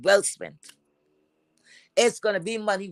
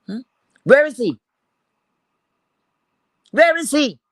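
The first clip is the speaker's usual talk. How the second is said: louder, raised-pitch demand; real challenging demand; 4 semitones higher, 5 dB louder than usual. Real, not acted. Vlogger challenging a rival about her absent man, loud demand. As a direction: throw the question out hard, then leave it hanging.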